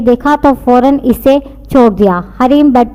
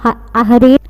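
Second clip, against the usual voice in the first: ordinary delivery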